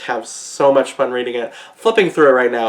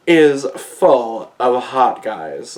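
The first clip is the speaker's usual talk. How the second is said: ordinary talk, nothing marked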